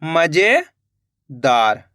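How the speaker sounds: मजेदार drawn out very slowly and low, syllables joined; slow teacher blending; slow blended word. Shown as a demonstration. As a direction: stretch मजेदार out as the letters combine. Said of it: "तो मिलके बन जाएगा अपने".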